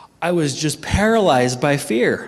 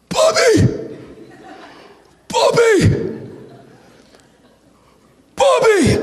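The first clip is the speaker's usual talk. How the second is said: very high, loud strangled squeak; fear-choked cry; 5 dB louder, much slower than usual. Retold or imitated. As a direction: act out a scream choked by fear. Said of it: "this is what's coming out of my mouth".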